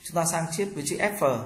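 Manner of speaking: ordinary talk, nothing marked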